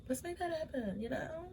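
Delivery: high, soft cooing voice; high cutesy voice. Pretend. Fan cooing high and soft about the cute couple. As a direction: coo it like a swooning fan.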